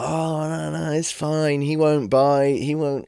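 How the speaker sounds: high, slow sing-song mock owner; lightly sneering parody of a dog owner; mocking impression. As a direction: mimic the clueless owner's reassurance. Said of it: "They're always like".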